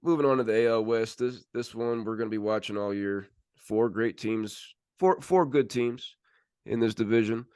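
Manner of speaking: usual speaking voice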